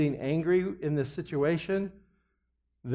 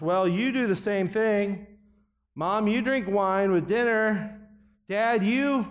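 defensive addict; 6 semitones higher, slower than usual. Retold or imitated. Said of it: "a hypocritical defense".

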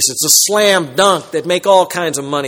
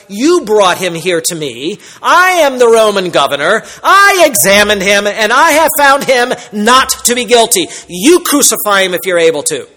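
a touch of a fed-up governor, louder; frustrated Pilate; 4 semitones higher, 6 dB louder than usual. Retold or imitated. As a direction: snap back at the accusers, mildly. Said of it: "It would mean something like".

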